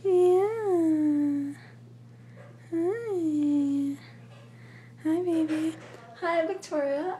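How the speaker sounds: high cooing voice to a pet. Real, not acted. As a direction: coo sweetly like talking to a kitten. Cooing to the cat.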